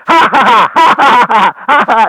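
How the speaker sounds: loud, high mad scientist cackle; rapid, shrieking villain's "ha-ha-ha"; maniacal mad laugh. Shown as a demonstration. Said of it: "Give me a maniacal mad laugh".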